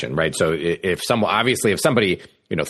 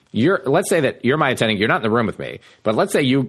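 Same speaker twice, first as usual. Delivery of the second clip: ordinary talk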